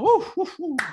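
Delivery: high, loud whoops; hammed-up "woo" cheers; mock crowd whooping. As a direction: whoop like a rowdy patriotic crowd. Mimics the rowdy American crowd.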